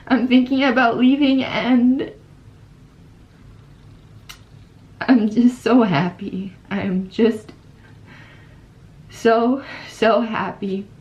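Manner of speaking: choked-up happiness about leaving; getting emotional. Real, not acted. Exchange student to her viewers about leaving, welling up. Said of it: "I'm getting emotional now".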